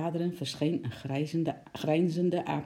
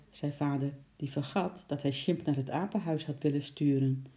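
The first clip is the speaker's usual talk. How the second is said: usual speaking voice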